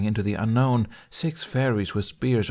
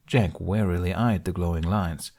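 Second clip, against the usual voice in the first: usual speaking voice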